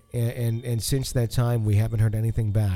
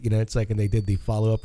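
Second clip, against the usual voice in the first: ordinary talk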